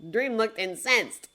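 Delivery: ordinary delivery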